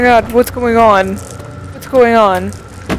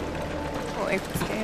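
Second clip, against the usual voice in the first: barely voiced frightened whisper; 4 semitones lower, 19 dB quieter than usual. Real, not acted. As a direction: breathe it out in fear. Scared, whispering.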